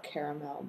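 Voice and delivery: caramel said as KARE-uh-mel, three syllables. Shown as a demonstration. Repeating critics' way, caramel with three syllables. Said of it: "that's how it's spelled out".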